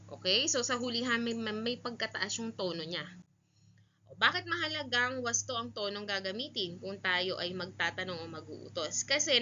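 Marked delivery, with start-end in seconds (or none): none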